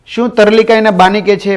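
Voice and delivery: normal talking voice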